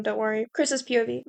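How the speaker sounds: ordinary delivery, nothing marked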